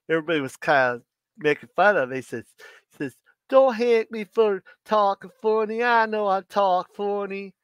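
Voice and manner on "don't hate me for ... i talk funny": a light imitation of a Tennessee accent; mild Tennessee accent. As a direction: lightly voice the Tennessee cousin's twang. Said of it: "had a real strong accent".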